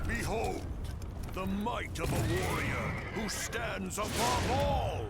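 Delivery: slow, high, booming war cry; battle shout. Acted. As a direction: roar it out to the battlefield. Warrior boasts before fight.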